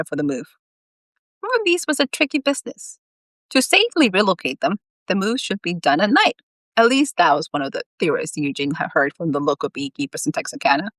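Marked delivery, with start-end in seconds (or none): none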